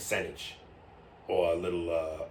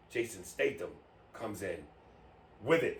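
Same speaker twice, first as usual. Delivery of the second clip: usual speaking voice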